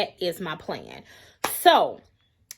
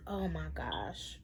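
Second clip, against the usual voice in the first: blocked nose, quiet groan; irritated stuffy nose; 5 semitones lower, 16 dB quieter, slower than usual. Real, not acted. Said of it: "I am so sorry about my nose".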